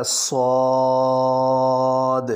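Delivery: صاد heavy, mouth full, held long; full-mouthed saad. Shown as a demonstration. Teacher models a heavy mouth-filling صاد. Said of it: "منہ بھر کے پڑھا جائے گا".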